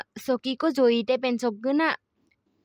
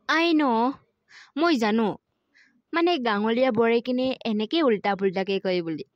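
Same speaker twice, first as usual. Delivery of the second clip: normal talking voice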